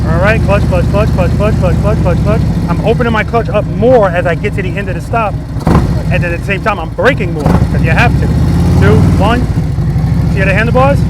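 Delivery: loud, carrying cues across the practice lot; coaching calls. Real, not acted. Riding instructor shouting cues to a student on the bike, loud. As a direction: call the clutch rhythm out to the rider.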